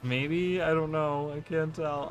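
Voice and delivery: ordinary talk, nothing marked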